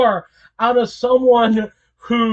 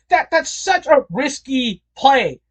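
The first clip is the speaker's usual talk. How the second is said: ordinary talk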